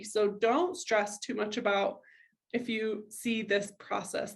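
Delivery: normal talking voice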